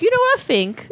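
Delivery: high put-on British girl voice; catchphrase of a Love Island girl; British reality show accent. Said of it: "my impression of one of the people".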